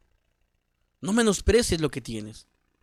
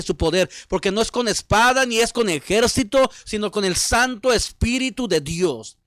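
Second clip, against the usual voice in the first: loud, driving sermon delivery; impassioned preaching; 4 semitones higher, 6 dB louder than usual. Real, not acted. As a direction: preach it out with rising force. Preaches at full force.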